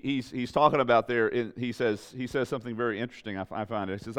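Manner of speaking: ordinary talk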